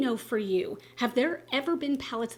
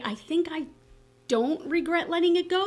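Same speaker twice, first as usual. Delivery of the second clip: unsure, pitched high as if asking; questioning; 7 semitones higher, 3 dB louder than usual. Real, not acted. Vlogger doubting her decision about a decluttered palette, questioning. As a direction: let real doubt lift the voice. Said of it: "You hear the questioning in my voice".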